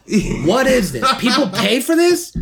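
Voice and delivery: can't believe what they're seeing; incredulous. Real, not acted. Repeated disbelief at the video.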